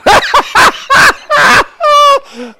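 sudden high whooping laugh; big shouted laugh; loud laugh. Real, not acted. Burst of laughter at class.

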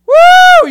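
very high loud whoop; exultant whoop. Real, not acted. Preacher whooping at a congregation that wears him out, very high and loud. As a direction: let out a joyful shout.

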